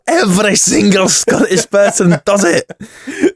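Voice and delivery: slow, hammed-up Scottish accent. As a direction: drag out a bad Scottish accent. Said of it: "Sorry about that terrible, terrible Scottish accent".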